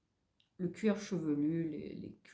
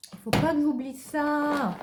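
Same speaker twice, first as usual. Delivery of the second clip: higher and louder, stressing pas; emphatic reminder; 8 semitones higher, 5 dB louder than usual. Shown as a demonstration. Reminding herself not to forget, louder and higher. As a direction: hammer home pas.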